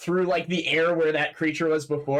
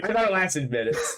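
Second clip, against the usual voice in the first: chuckling through the words; laughing; 4 semitones higher than usual. Real, not acted. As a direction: laugh while admitting the mistake. Laughing at own mistake.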